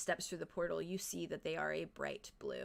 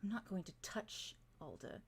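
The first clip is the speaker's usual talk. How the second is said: quiet menace; 10 dB quieter than usual. Acted. Villain voiced, low reassurance.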